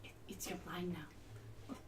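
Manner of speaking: whispered prompt; hushed aside to partner. Real, not acted. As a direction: whisper the cue so as not to break the scene. Whispered cue to scene partner.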